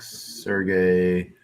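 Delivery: ordinary talk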